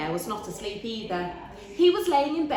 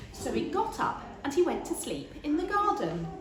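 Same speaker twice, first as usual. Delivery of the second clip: plain reading voice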